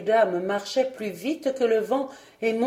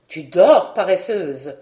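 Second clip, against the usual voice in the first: a sharp, loud scolding wake-up call; angry scolding; 2 semitones lower, 8 dB louder than usual. A narrator's reading. Aunt scolding sleepy Catherine, loud and angry. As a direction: snap her awake, louder. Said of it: "d'un air fâché".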